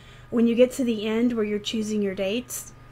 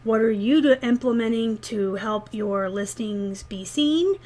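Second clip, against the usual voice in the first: ordinary talk, nothing marked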